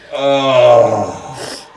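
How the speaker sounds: loud groan of relief; loud relieved groan. Real, not acted. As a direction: let out a big satisfied groan. Relief under strong pressure.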